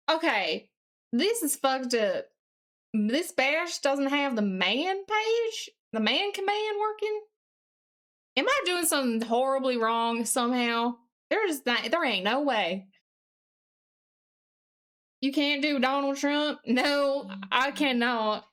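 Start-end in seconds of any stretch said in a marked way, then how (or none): none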